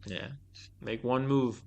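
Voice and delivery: ordinary talk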